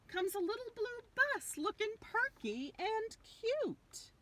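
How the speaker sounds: high, sweet sing-song voice; perky and cute. A narrator's reading. Reading to children, sweet high cute voice. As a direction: lift it up bright and dainty.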